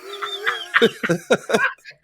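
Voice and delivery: high-pitched laugh. Real, not acted. Cracking up after a movie impression, laughing.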